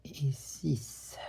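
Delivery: whispered; whispered count. Real, not acted. Counting stitches under breath.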